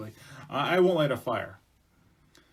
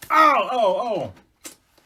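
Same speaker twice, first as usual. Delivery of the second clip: loud, high yelps of pain; acted "ow" howl; pained cries; 9 semitones higher, 10 dB louder, much slower than usual. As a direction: cry out as if hurt, then fade. Woman bangs her hand with flint.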